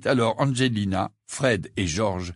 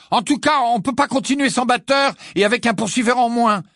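renfrogné; 12 semitones higher, 5 dB louder than usual. A narrator's reading. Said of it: "d'un air renfrogné".

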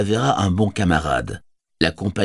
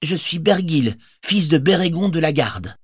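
proud; 10 semitones higher than usual. Acted. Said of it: "se dressa avec fierté".

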